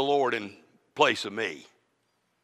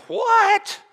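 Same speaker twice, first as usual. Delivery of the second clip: high, startled mock disbelief; incredulous what. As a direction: squeak out the shock. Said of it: "I'm thinking".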